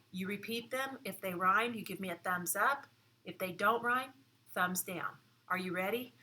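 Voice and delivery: normal talking voice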